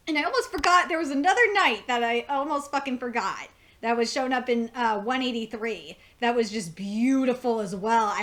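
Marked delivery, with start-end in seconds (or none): none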